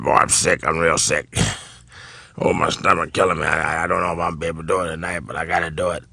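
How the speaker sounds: low, soft, rapid grumble; sickly low muttering, acted; imitating ailing older comedian. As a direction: mumble your complaints low, as if sick. Acts Foxx complaining he is sick.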